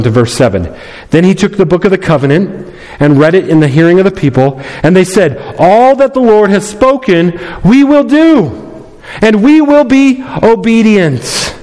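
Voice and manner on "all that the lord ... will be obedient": much higher and very slow, a fervent promise; eager people's vow. Acted. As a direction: sing out "we will do" like a crowd swearing an oath. People's vow, retold with emphasis.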